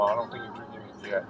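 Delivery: ordinary delivery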